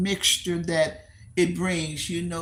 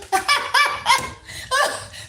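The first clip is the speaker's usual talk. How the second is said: high laugh; 3 dB louder than usual. Real, not acted. Laughs after realizing.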